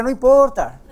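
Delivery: agudo y fuerte, marcando "no importa"; recalcado fuerte. Real, not acted. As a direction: golpear "no importa" con énfasis. Pastor recalca con fuerza. ("no importa" casi gritado)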